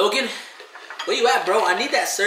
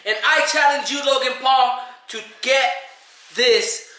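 hyped challenge; 6 semitones higher than usual. Real, not acted. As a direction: throw down the challenge. Challenging Logan Paul on camera.

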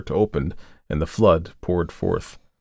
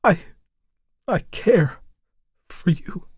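high, halting, fading to a whisper; Tessie's shy confession; 11 semitones higher than usual. Acted. Tessie confessing her love to the painter, halting into a whisper. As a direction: barely dare say it.

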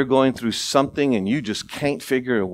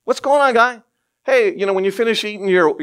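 mock casual greeting; 8 semitones higher, 4 dB louder than usual. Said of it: "You wouldn't do the little wave".

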